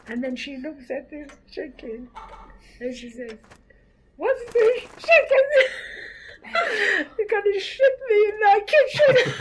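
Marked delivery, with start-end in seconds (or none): 4.2-9.3 much higher and louder, laughing through it; mother's outraged shout. Retold or imitated. Mother scolding over the chicken, laughing shout.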